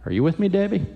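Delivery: ordinary delivery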